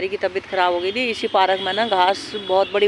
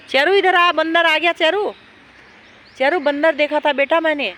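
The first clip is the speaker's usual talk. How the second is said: calling the dog; 7 semitones higher, 4 dB louder than usual. Real, not acted.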